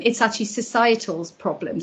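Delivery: normal talking voice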